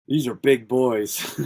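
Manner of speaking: slow, laughing through the words; chuckling at how big they are. Real, not acted. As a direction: laugh it out, amazed at their size. Laughing at huge competitors.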